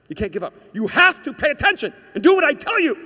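building to a high, loud command; insistent inner voice. Acted. Inner voice overruling the self, rising to a shouted command. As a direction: start firm, then shout the order.

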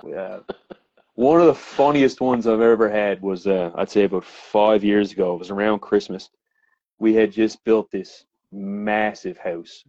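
ordinary talk

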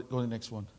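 ordinary delivery

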